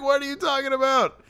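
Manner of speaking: high mock voice of the brother confused at being yelled at; whiny brother. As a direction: squeak out a hurt protest. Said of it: "And his brother's like".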